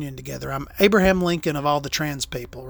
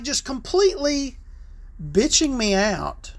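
ordinary delivery

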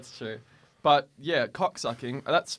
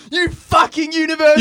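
high, loud mock yell; Craig yelling; 12 semitones higher, 9 dB louder than usual. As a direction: shout at passing students. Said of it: "Craig goes".

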